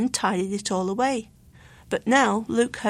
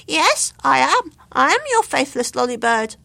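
lightly voiced chirpy bird; 3 semitones higher, 6 dB louder than usual. A narrator's reading. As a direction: answer from above the crowd. Bird answers Luke.

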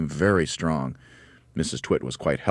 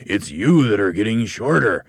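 raised, louder, relishing the bad news; horribly gloating; 6 semitones higher, 7 dB louder than usual. A narrator's reading. Husband telling his wife she is shrinking, gloating loudly. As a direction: lean on YOU with gleeful menace. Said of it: "grinning horribly".

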